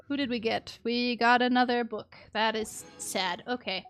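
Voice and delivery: ordinary delivery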